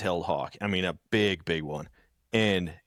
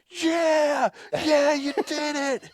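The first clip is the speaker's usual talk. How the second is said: much higher and louder, drawn-out cheer; cheering crowd; 4 dB louder, much slower than usual. Retold or imitated. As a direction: cheer him on like a thrilled coworker. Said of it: "And everyone's like".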